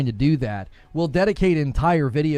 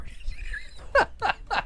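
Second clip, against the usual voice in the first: high breathy laugh; 6 dB quieter than usual. Real, not acted. Co-host laughing at the clip, high burst.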